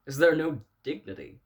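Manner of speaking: loud, high-pitched indignant demand; indignant outburst. Acted. Man appalled at people yammering into their phones, indignant outburst. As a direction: cry out in offended disbelief.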